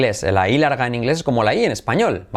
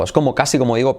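ordinary talk, nothing marked

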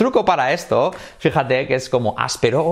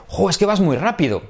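higher-pitched acted everyday remark; colloquial exclamation; 7 semitones higher than usual. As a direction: toss it out as an impressed exclamation. Acts out colloquial Spanish remark. (exclamación coloquial, más aguda)

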